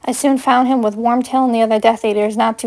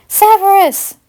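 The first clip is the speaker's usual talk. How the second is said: high, loud call across the distance; shouting his name; 9 semitones higher, 4 dB louder, slower than usual. Acted. Paige shouting for Severus across the grounds, loud. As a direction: call out to him from far off. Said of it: "I shouted his name loudly in the distance".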